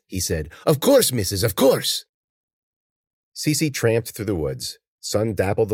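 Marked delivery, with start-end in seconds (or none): 0.6-2.0 high, obliging character voice; obedient servant. Acted. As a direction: hurry to please the mistress. Servant obeys his mistress.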